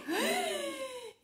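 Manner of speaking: big theatrical gasp with a laugh; high mock gasp. Reader gasping as the baby takes the case. Reader reacts to briefcase taken.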